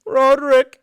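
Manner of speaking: high, loud mock summons; calling the knight. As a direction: holler the champion's name across a court.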